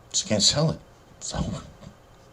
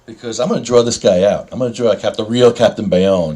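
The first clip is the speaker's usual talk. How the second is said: ordinary talk, nothing marked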